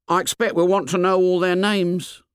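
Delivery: high-pitched schoolboy character voice; fat boy's voice. A narrator's reading. Fat boy hinting to Ralph, high boyish voice. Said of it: "said the fat boy".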